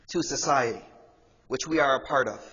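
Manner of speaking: ordinary talk, nothing marked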